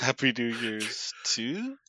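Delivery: very slow, questioning "too"; drawn-out doubtful echo of the greeting; mock question. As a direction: stretch it out and lift "too" at the end. Echoing the question-mark typo.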